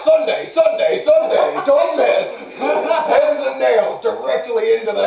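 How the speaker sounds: loud, high hype announcer; monster truck ad. Pretend. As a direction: yell it like a monster truck promo. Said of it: "a monster truck commercial".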